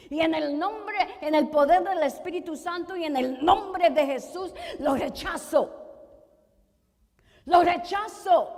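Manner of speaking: fervent shouted rejection, hard on each phrase; forceful rebuke. Acted. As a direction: renounce it with full authority. Preacher rejects it in Jesus' name.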